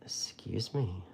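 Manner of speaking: a soft breathy whisper through a yawn; whispered excuse me. Real, not acted. Painter to viewers, stifling a yawn, whispered apology. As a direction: murmur it while stifling a yawn. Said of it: "I'm yawning".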